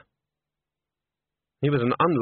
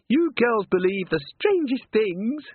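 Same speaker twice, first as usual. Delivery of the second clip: high, slow, superior; condescending; 10 semitones higher, slower than usual. A narrator's reading. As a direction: talk down to her. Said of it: "tutting sound that Agnes considered quite offensive".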